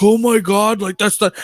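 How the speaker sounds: defensive friend. Retold or imitated. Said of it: "he starts getting all fucking defensive".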